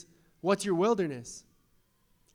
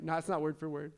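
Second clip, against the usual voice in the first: ordinary delivery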